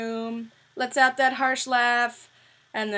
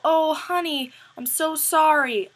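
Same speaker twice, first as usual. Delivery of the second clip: mock sympathy; 6 semitones higher, 6 dB louder, slower than usual. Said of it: "instead of doing the typical".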